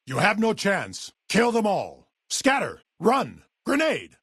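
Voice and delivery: high, urgent battle commands; combat shouting. Acted. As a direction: call out orders under fire. Shouting orders and warnings in combat.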